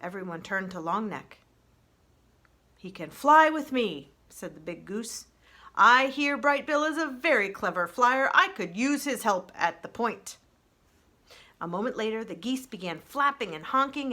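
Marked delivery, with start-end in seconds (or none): 2.8-4.1 big goose. Acted. 5.7-10.4 big goose. Acted.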